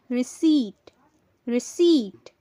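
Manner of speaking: "रिसीट" as ri-SEET, p silent; long ee then t in "रिसीट", no p; correct pronunciation. Shown as a demonstration. Teacher giving learners the correct form, "रिसीट" as ri-SEET. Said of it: "correct pronunciation होगी".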